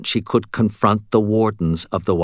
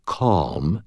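ordinary delivery, nothing marked

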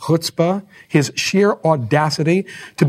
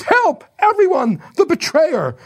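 screaming for help. Retold or imitated. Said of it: "Donna Anna screams".

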